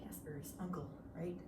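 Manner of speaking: soft whispered aside; whispered joke. Real, not acted.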